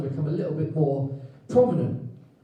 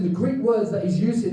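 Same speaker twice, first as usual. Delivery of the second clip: ordinary delivery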